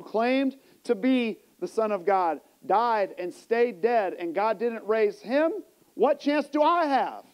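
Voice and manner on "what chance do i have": ordinary talk, nothing marked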